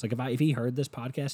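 usual speaking voice

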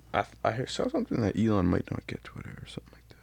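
hushed whisper of a rumor. Real, not acted. Friend whispering a Twitter rumor to his co-host, real hushed whisper. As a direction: breathe it under your voice like gossip.

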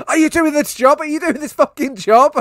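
high, loud, badgering gangster yell; bully him for an answer, hammed-up and loud; imitated angry demand. Acts out the gangster's aggressive demand.

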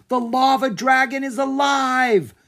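high, loud cry of amazement; boy shouting that the dragon lives; excited cry. Acted. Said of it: "Drake cried".

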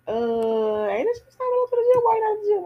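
hammed-up high pitch pestering friend; mocking high nagging voice. Teasing the vlogger about skipping gym, high mock nag. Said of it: "My people were like".

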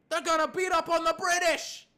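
high, loud battle-cry prediction; loud hype. Real, not acted. American fan predicts beating the British, loud and hyped. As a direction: shout it like a rallying fan.

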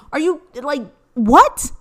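sharp disbelieving what; high loud disbelief on what; incredulous. Pretend. Reacting to being sprung on granny, incredulous.

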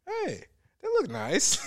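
high, slow teasing voice; lightly voiced smitten friend; mocking friend. Mocking friend ogling women.

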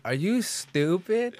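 high, drawn-out mock disbelief; retold incredulity. Retelling his comeback to his girlfriend, incredulous. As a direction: stretch it out slowly and high, as if baffled.

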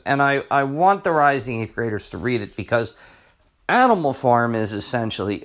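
usual speaking voice

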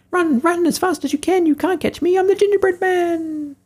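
very high, tiny cartoon voice taunting; sing-song it like a cheeky little cookie; squeaky gingerbread man. Gingerbread man taunts the cook, squeaky high voice.

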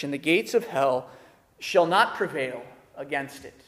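measured, solemn Bible reading; a pastor reading Matthew to his flock, solemn; scripture reading. Acted. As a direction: read the passage with weight. Reads scripture passage.

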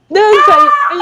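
bem mais agudo e alto, gritando da plateia; torcida animada. Retold or imitated. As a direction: grite incentivando a bailarina. Colegas torcendo na plateia. (grito animado de torcida)

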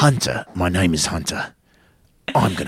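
East London tough guy, Statham-style; Hunter. Acted. Podcaster hamming up the character over protests, East London accent. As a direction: introduce yourself like a London hard man. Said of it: "He's kinda like Jason Statham now".

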